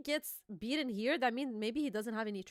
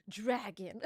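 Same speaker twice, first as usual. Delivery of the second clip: low, softer one-word call; underwhelming attack call; 4 semitones lower, 5 dB quieter than usual. Acted. Kaido with no time for naming, low underwhelming call. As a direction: drop low on the lone word, mock-serious. Mocks the plain attack name.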